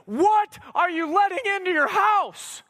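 high, drawn-out preacher's challenge; urgent pulpit appeal; impassioned preaching. Real, not acted. Preaches at full force.

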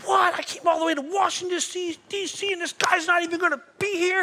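high, loud outraged exclamation; a shocked, mock-outraged cry; indignant disbelief. Pastor hearing the keynote speaker cancelled, high loud disbelief. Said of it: "And I'm, like, going".

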